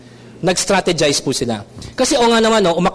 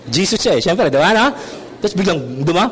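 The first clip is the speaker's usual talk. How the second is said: ordinary delivery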